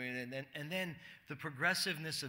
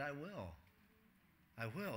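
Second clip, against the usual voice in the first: much softer, tender reassurance; almost hushed, let it land; gentle Jesus; 3 semitones lower, 12 dB quieter than usual. Acted. Jesus answers the kneeling sinner, softly and tenderly.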